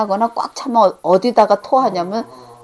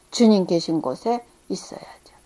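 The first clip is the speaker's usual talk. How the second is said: whisper; 4 semitones lower, 9 dB quieter than usual. Acted. Hushed close of point.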